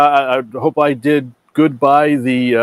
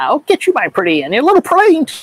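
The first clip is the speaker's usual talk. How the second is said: high screechy wicked witch; 13 semitones higher than usual. Pretend. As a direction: cackle the threat like a witch. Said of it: "people commending me on my witch voice".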